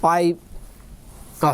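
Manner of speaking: ordinary talk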